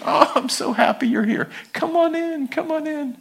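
gushing, high-pitched welcome; Peter. Acted. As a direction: usher in a dear friend, delighted. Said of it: "gives him a big hug and says".

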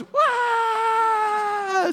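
high, loud shriek of fright; startled crowd shriek. Acted. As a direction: shriek as the dead man walks out. Crowd shrieks at risen Lazarus.

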